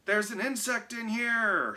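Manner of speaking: high, loud alarmed shout. A narrator's reading. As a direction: yell it in alarm. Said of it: "shouted Mike".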